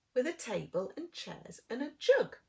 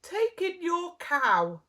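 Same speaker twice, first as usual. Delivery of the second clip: slow, higher sage advice; wise old man; 7 semitones higher, 7 dB louder, slower than usual. Acted. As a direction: offer it slowly like a sage. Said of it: "said the wise old man".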